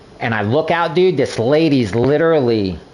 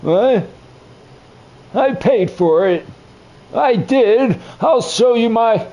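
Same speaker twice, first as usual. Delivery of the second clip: put-on high, slow, flustered old man; 10 semitones higher, slower than usual. Old man told he can't eat there, high and flustered. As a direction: protest like a confused grandpa. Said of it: "old man at restaurant".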